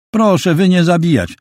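put-on high, pleading voice of a scared girl; frightened broken Polish. A narrator's reading. Scared girl pleading with the two men, high and pleading. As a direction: beg them not to kill her.

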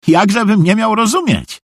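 high, eager-to-please voice; high-pitched obedient fool; eager naive simpleton. A narrator's reading. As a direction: confess everything readily in a high voice. Narrator voices naive Szwejk.

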